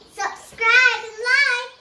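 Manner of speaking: loud, high, bouncy sign-off; excited outro. Real, not acted. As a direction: shout the sign-off with glee. Outro call to viewers.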